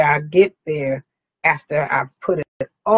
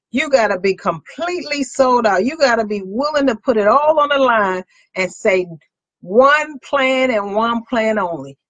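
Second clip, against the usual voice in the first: rally speech; 4 semitones higher than usual. Acted. Preaching at full force.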